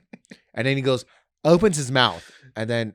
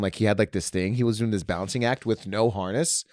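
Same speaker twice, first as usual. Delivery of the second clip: ordinary delivery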